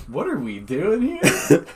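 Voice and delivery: high, slow put-on voice; mock-bewildered high voice; confused question. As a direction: ask it like you're lost. Retold confused reaction.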